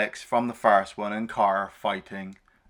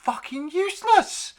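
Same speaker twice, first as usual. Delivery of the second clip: sarcastic; 12 semitones higher than usual. Retold or imitated. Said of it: "And I'm like".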